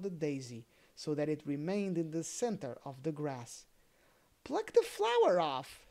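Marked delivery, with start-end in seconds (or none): 4.4-5.9 put-on high, loud boyish command; boy's order. Other boy urging to pluck the daisy, high and loud. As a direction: bark it out like an eager boy. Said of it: "said the other boy".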